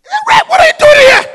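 high, loud, startled shout; shocked greeting. Retold or imitated. Karen, surprised to see the pastor on the bus, shouting high. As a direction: cry out in shock at seeing him. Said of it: "She was shocked".

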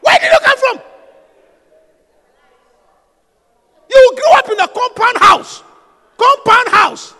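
high, loud, scolding questions; loud rebuke. Real, not acted. Preacher scolds the complaining wife about her past, shouting high and loud.

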